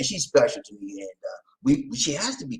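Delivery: ordinary delivery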